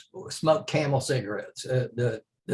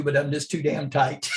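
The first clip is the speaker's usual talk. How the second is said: laughing; 6 semitones higher than usual. Retold or imitated. Friend's cheeky retort, laughing.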